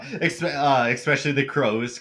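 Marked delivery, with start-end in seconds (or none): none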